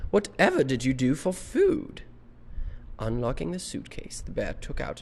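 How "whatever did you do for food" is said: loud, higher, amazed question; high-voiced woman. Missus Brown asks the stowaway about food, high-voiced. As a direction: ask in an astonished lady's voice. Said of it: "this is the woman with the high voice".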